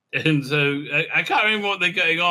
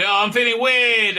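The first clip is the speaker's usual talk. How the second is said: high, louder put-on whine; mock whiny complaint; 7 semitones higher, 4 dB louder than usual. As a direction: whine it like a spoiled patient.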